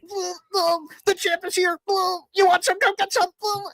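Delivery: very high malfunctioning toy voice; squeaky catchphrases from a soggy talking figure; waterlogged action figure. Acted. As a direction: rattle off slogans like a broken toy. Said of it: "his action figure got water in it".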